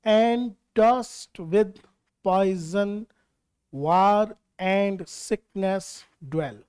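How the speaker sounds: very slow, syllable by syllable in iambic beat; slow metered verse reading, each stress set apart; scanned verse line. Shown as a demonstration. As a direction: read the line word by word, marking the meter. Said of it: "कोई सी भी आप ले सकते हैं".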